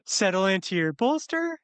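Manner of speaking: every line rising like a question; up talk yoga teacher. Shown as a demonstration. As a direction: lilt upward like a yoga instructor. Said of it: "sounds like everything you're saying is a question".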